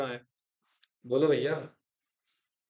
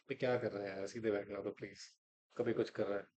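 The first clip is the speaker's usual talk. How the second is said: irritated aside; 6 semitones lower, 9 dB quieter than usual. Real, not acted. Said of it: "so irritating for me".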